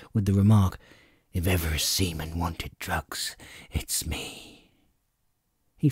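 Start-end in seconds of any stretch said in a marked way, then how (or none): 1.3-4.7 low, faint sick sailor; weak murmur. A narrator's reading. Ailing captain mutters to Jim, weak and low. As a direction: barely voice it, fading.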